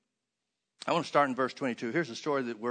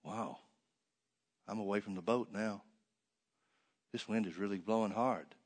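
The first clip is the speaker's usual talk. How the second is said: softer and lower, Peter's worried thoughts to himself; quiet inner thought; 6 semitones lower, 8 dB quieter than usual. Retold or imitated. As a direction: murmur it as the fear creeps in. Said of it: "he starts thinking".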